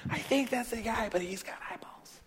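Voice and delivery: soft aside whispered to a neighbour; whispered gossip. Pretend. Crowd gossiping in disbelief.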